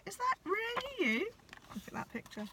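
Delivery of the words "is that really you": put-on much higher, wide-eyed girl; little girl voice. A narrator's reading. Molly asking Santa in the chimney, high and amazed. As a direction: ask like an amazed small child. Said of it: "she asked".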